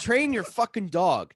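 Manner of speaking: exasperated retold order; acted angry demand. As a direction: re-enact yelling at your sister, each word drawn out. Retold quarrel with sister.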